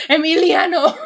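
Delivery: laughing, crying out the name loud; dramatic cry. Acted. Streamer crying out a donor's name in mock drama. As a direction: wail it like a soap-opera scene.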